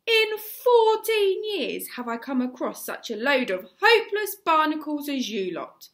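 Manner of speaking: loud, high, furious tirade; exploding at the boys. A narrator's reading. Gobber exploding at the shivering boys, furious shout. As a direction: roar it out in a towering rage. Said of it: "he exploded all over the place".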